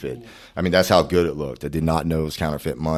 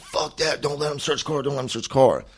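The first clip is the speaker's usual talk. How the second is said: high-pitched, urgent objection; retold protest, pitched way up; 10 semitones higher than usual. Man objecting to the car search, urgent high protest.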